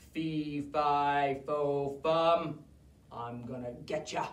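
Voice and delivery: a giant promising to catch them; low giant threat; growly giant. Acted.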